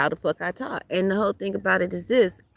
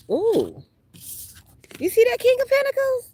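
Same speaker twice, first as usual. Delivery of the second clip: much higher, loud excited squeal at the card; surprised; 4 dB louder than usual. Real, not acted. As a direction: gasp it out, delighted by the card. Tarot card surprises her.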